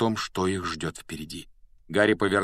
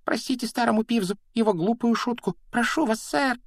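very high, squeaky apologising; frantic servile wheedling, high-pitched; obsequious grovelling; 4 dB louder than usual. A narrator's reading. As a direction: beg forgiveness in a shrill imp voice. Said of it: "подобострастно заюлил он".

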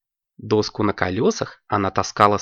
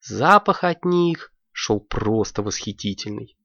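usual speaking voice

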